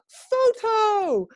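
high, loud drawn-out cry; imitated high, eager call; excited exclamation. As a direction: shriek it as the thrilled wife. Wife's excited cry acted.

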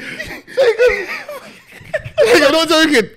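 real laughing-crying fit; helpless laughter. Real, not acted. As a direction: insist while cracking up. Helpless laughter, insisting it is true.

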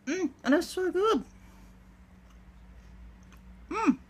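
talking while chewing; muffled through food; mouth full. Real, not acted. Seen 3: Bites at -3, cheeks full and chewing throughout labelled words.

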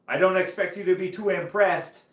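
high, loud imitated French accent; showman's patter in mock French accent. French rider doing ball tricks, imitated French accent. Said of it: "he sounded like George Saint Pierre".

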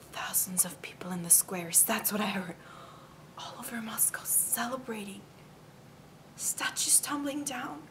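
hushed conspiratorial whisper. Acted. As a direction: whisper it like a secret. Secret news whispered to sister.

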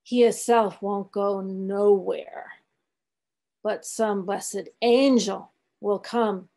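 put-on very slow, reverent old man; slow, solemn. A narrator's reading. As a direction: testify gravely, drawing out each word. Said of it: "changing his whole air and demeanor".

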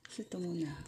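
soft unvoiced whisper. Real, not acted. Choosing pet snacks, a hushed whisper to herself. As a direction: breathe it as a private aside.

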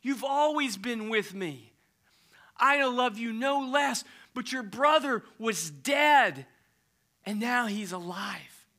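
calm a hurt son; gentle father. Acted. Father to resentful son, gentle and slow.

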